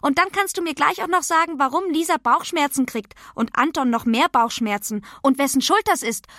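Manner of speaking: höhere, aufgekratzte Kinderstimme; vorlaute Pippi. Acted. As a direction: plappere als Pippi frech weiter. (kecke Pippi-Stimme, etwas lauter)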